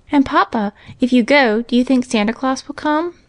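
put-on lightly anxious child's question; Johnny, anxious. A narrator's reading. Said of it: "asked Johnny anxiously".

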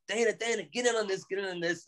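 playful high-pitched hype; imitating drunk Joe. Drunk Joe pulling Dana in.

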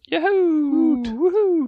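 high whooping cheer. Real, not acted. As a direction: whoop it out in joy. Cheering after level gain.

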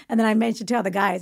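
ordinary delivery

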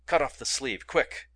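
loud, urgent order; urgent shout. A narrator's reading. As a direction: bark the order fast. Urgent command during struggle.

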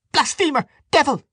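very high, shrieked accusations; scream. A narrator's reading. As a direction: scream each word at him in outrage. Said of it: "screamed Dante".

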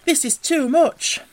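high, loud, drawn-out rapture; overwhelmed with delight, stretch it out; ecstatic. A narrator's reading. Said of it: "cried the mole in ecstasies".

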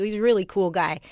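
ordinary delivery

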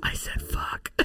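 unvoiced hushed whisper; whispered confession. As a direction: breathe it out like a secret.

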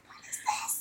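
unvoiced, hushed question; whispered aside in a dark theater. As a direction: whisper it to the friend beside you. Retold whisper to friend.

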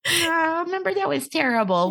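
high, sheepish sweet-talk; mock cutesy making-up. Host mimics a girlfriend smoothing things over, high and cutesy. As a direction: imitate someone sheepishly glossing over a fight.

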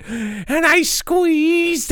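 slow dramatic. Acted. Dramatic retelling.

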